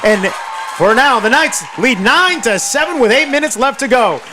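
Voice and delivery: excited, high-pitched play-by-play; triumphant football announcer shout; touchdown call. Real, not acted. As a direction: belt out the score as it happens.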